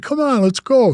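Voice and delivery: high, eager urging; rally the guys to come along, pitched well up; eager call. Retold or imitated.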